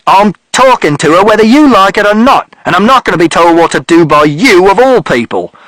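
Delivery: loud, furious father yelling at mother; father shouting. A narrator's reading. Father yelling at mother and Mister Shears, furious shout. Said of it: "And father shouted".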